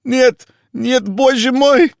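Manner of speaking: very high, loud terrified Russian cry; crying out in panic. A narrator's reading. Panicked man screaming in Russian, terrified. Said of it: "The voice cried out".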